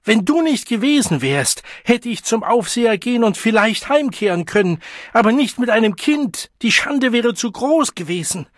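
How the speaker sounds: high, loud sustained rant; furious bellowing. A narrator's reading. Mother blaming her child for her lost return home, bellowing. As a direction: keep shouting the blame in rage. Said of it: "brüllte sie".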